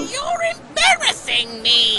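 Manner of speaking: high whining. Acted. Scolding wife.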